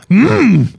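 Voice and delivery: high, loud creaky creature hum; Yoda. Shown as a demonstration. Presenter showing how the impression has to start, high creature hum. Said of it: "I could do my Yoda voice".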